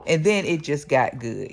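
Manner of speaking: ordinary delivery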